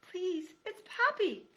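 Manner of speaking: small high pleading voice; tiny scared mouse, begging; timid mouse voice. Acted. Mouse Poppy.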